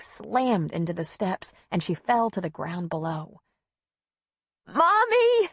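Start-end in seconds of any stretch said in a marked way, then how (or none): 4.7-5.5 put-on very high, loud child's cry; yelping. A narrator's reading. Child watching mother fall, terrified yelp. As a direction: yelp out in terror for your mother. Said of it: "Callie yelped".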